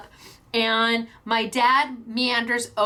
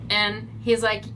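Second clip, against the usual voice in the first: ordinary delivery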